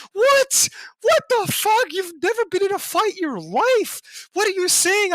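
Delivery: high-pitched, indignant disbelief; incredulous outrage. Retold or imitated. Outraged reaction to the lady's advice.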